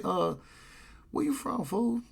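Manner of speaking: high, soft, casual question; retold non-confrontational check-in; not banging, just asking. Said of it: "not banging on him".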